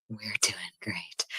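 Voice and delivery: slow whispered reassurance about a failing stream; whispered aside. Real, not acted. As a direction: whisper it slowly under your breath. Downplaying the failing internet stream.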